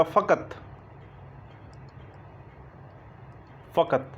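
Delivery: फ़कत with fricative f and throaty q; nukta word. Shown as a demonstration. Teacher modelling the Urdu word for students. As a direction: voice फ़कत with both nukta sounds. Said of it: "फ़ा जो है उसके नीचे भी नुक्ता".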